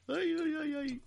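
high put-on wail; mock crying. Moved by the tearful scene, high mock wail. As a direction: whimper high in fake tears.

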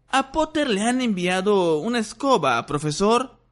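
high, hurried schoolboy telling on him; quick tattling. Acted. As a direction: blurt it out to the teacher. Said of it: "dijo rápidamente Malfoy".

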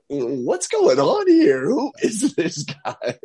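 mock bewildered adults; baffled teachers. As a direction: act out the confused staff, drawn out. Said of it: "shaking their heads going".

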